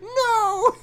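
very high, loud drawn-out cry; high wail of dismay. Real, not acted. Podcaster realising they quoted Doctor Seuss, high wail. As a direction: wail it high in amused horror.

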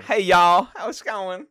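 high, loud mock Texan accent; Texan drawl. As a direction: put on a big Texan twang. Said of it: "Texan drawl".

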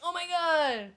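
excited. Real, not acted. Reacting to the book topic.

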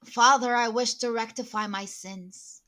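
loud, drawn-out vow, weighted word by word; solemn declamation. Pretend. Penitent speaking to Father, loud mock-solemn declamation. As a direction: proclaim it like a grand oath. Grand confession spoken in character.

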